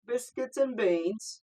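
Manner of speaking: slow, loud, high mock English voice; mock English accent. Chiming in on the accent challenge, mock English accent. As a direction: draw out a hammed-up English accent. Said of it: "Say the line in your English accent".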